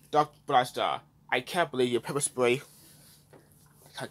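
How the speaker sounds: ordinary talk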